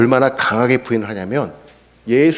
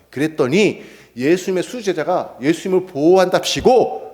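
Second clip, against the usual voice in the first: ordinary talk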